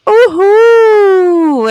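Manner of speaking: usual speaking voice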